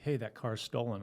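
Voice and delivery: ordinary delivery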